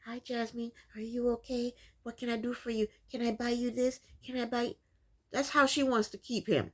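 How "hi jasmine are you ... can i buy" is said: soft, eager-to-please mock voice, much softer; docile boyfriend. Mocking Gino fawning over Jasmine, soft and meek. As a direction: imitate a meek man fawning over his girlfriend, softly. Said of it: "docile and like".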